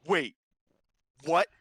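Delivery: halting, intent disbelief with a long pause; mock intense reaction; imitated intensity. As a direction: act out being gripped, then baffled. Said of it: "it goes from intense to just like".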